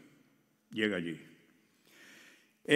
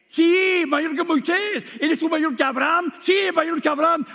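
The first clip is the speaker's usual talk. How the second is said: much higher, louder triumphant answers; emphatic yes; 14 semitones higher, 9 dB louder than usual. Acted. Preacher answering the woman's challenge, triumphant and loud. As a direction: answer with ringing certainty.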